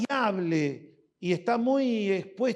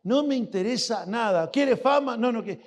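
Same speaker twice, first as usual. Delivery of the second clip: normal talking voice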